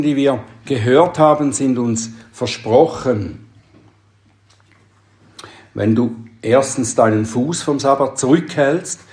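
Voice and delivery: ordinary talk, nothing marked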